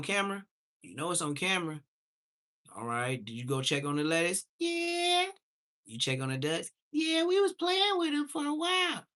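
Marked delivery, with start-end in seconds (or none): 4.6-5.3 put-on squeaky little kid asking for breakfast; high kid voice. Kid answering parent. 6.9-9.1 put-on squeaky little kid asking for breakfast; high kid voice. Kid answering parent.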